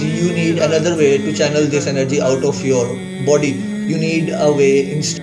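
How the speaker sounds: ordinary talk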